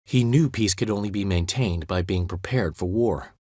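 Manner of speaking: ordinary delivery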